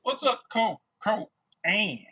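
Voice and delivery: high, loud mimicking of a friend's greeting; like Daniel. Greeting Cole on stream the way Daniel says it, high mock voice. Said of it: "I gotta say it like Daniel does".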